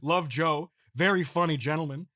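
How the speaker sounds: ordinary delivery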